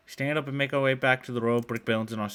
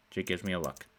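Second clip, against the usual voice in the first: ordinary delivery, nothing marked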